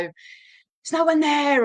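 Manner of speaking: slow, high, drawn-out yell at the dog; exasperated mock yell. Owner yelling at the barking dog, high and slow. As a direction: stretch it out, high and annoyed. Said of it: "get quite, um, you know, quite loud".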